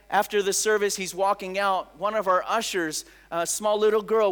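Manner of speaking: ordinary talk, nothing marked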